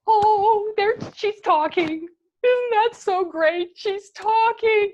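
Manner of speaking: loud, high, drawn-out gushing; thrilled parent. Retold or imitated. Parents hearing baby's first babble, hammed-up delight. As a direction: marvel over a baby, big and high. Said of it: "Your mommy and your daddy said".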